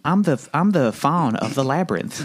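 high, hesitant Southern faun; Southern accent. Acted. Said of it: "In a Southern accent".

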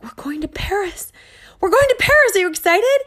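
high, rising giddy squeal; excited singsong. Retold or imitated. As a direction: tease them with a high, giddy chant. Singsong taunt at unhappy kids.

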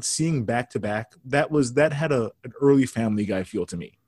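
ordinary talk, nothing marked

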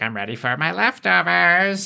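high, greedy creature voice; Morley the scavenger. Retold or imitated. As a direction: chirp it like a hungry little pest. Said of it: "Morley comes in and is like".

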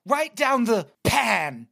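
slow, high mock British upset line; mock British mom. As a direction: ham up a prim British mother's lament. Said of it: "she's really upset".

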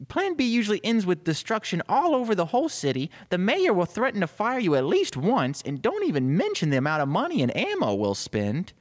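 high, rolling tirade of warnings; protesting assistant. Acted. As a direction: rattle off objections to the boss. Assistant protests the chief's plan.

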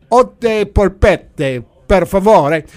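loud, slow tourist Italian; bad Italian accent. Customer ordering from the pasta lady, bad Italian accent. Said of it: "in my bad Italian accent".

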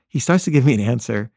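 normal talking voice

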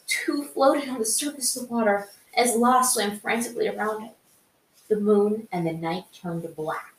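dramatic fan fiction narration; story narration. A narrator's reading. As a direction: read the chapter out like an audiobook. Reads the fan fiction chapter.

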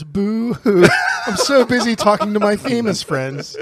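fake crying. Mock whining about busy friend.

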